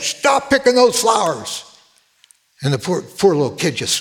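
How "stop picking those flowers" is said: put-on high, slow angry shout at a child; retold yell. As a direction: bark it out at the kid, full of anger. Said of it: "I yelled at him".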